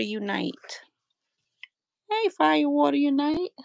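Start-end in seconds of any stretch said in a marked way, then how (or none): none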